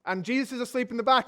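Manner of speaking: ordinary talk, nothing marked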